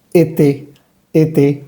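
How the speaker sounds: E T as "é-té", both vowels Spanish e; careful French été for E T; French é sound. Shown as a demonstration. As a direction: say E T smiling, two clear é sounds. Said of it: "la letra E como en español".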